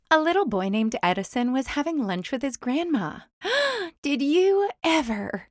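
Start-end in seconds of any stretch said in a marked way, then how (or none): 3.4-4.0 high sharp gasp; grandma startled. A narrator's reading. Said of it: "Grandma gasped". 4.0-5.5 high slow astonishment; grandma amazed. A narrator's reading. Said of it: "Grandma gasped".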